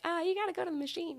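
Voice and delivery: high, quick, lightly voiced staff member; imitated shop worker. Coffee worker sending customer to machine, imitated, high and quick.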